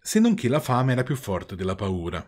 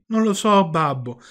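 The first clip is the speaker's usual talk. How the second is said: high, loud puppet voice; distressed puppet; 7 semitones higher, 5 dB louder than usual. A narrator's reading. Puppet recounts the dreadful night to his father, distressed high voice. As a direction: pour out the woeful tale, pitched well up.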